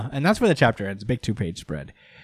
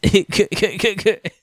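high mock evil cackle; hammed-up villain laugh; 8 semitones higher, 3 dB louder than usual. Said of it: "just like".

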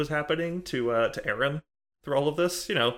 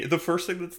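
ordinary delivery

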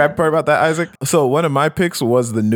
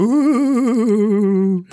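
high, hollow ghostly ooh; 6 semitones higher than usual. Shown as a demonstration. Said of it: "You go".

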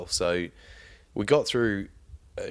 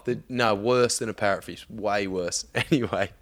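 normal talking voice

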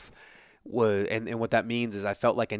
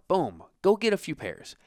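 ordinary delivery

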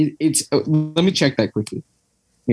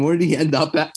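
talking through hiccups; words broken by hiccups; hiccuping; 2 semitones higher, faster than usual. Real, not acted. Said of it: "I'm, I'm hiccuping now".

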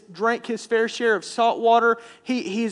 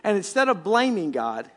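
normal talking voice

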